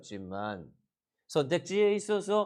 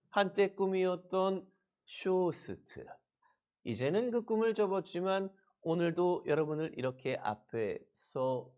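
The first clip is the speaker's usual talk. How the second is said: normal talking voice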